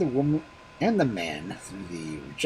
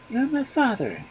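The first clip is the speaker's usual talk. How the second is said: put-on very high baby voice; a child naming his bones; 13 semitones higher, 4 dB louder than usual. A narrator's reading. Baby naming the magic bones, very high.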